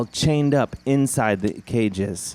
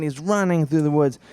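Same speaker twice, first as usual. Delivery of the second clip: ordinary delivery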